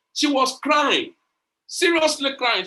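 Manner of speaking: crying and seriously stretched out slowly, pitched high; emphatic. Real, not acted. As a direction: lean hard on "crying", said slow and high. Stressing how hard she cried.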